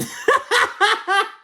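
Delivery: a high, loud burst of real laughter; cracking up at the funny moment. Real, not acted. Recapping the show, she bursts into loud laughter.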